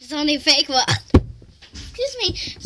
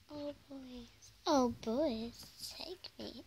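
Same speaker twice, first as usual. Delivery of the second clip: mock flirt; 12 dB quieter, slower than usual.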